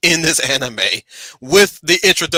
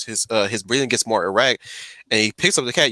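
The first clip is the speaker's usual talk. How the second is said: ordinary talk, nothing marked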